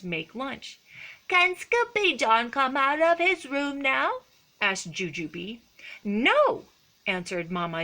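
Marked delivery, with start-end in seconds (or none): 1.3-4.2 put-on high, pleading kid voice; little kitten sister. A narrator's reading. Jujubee asks Mama in the kitchen, high childlike voice. 6.0-6.6 firm mother. A narrator's reading. Mama answers firmly.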